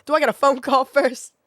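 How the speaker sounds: laughing mock begging; hysterical laughing plea. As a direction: plead loudly while cracking up.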